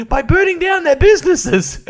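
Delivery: very high squeaky cartoon plea, breaking into laughs; Toadstool. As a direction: plead in a tiny high voice. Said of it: "Toadstool comes up and he's".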